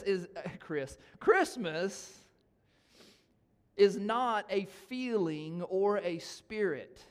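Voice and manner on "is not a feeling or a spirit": slow, loud and higher; emphatic preaching. Acted. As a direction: hammer it home from the pulpit.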